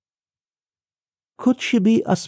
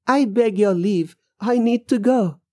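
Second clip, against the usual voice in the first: higher-pitched, polite excuse; anxious to leave; 7 semitones higher than usual. Acted. Man backs away from a ghost, anxious to leave.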